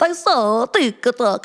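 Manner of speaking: sing-song put-on Italian accent. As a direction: replay a silly Italian-style accent. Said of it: "I started with".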